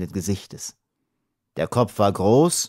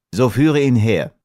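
firm, louder command; commanding order; 3 semitones higher, 6 dB louder than usual. A narrator's reading. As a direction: give a brisk chiefly order. Chief gives an order.

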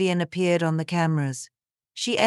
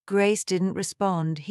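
usual speaking voice

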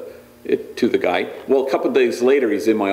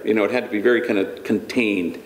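ordinary talk, nothing marked

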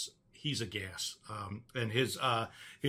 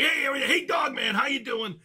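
Texan greeting, imitated with raised pitch; Mark Howard; 10 semitones higher, 3 dB louder than usual. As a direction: call out like a hearty Texan buddy. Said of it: "And he talks like that".

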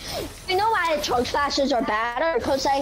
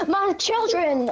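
dramatic wail; 6 semitones higher, slower than usual. Acted. Mock lament over lost items.

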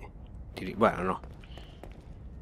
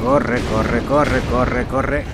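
urgent, louder run chant; being chased; 5 dB louder, much slower than usual. Real, not acted. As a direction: urge yourself on in panic. Fleeing again in game.

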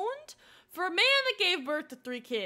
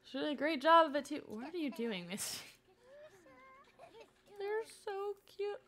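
ordinary talk, nothing marked

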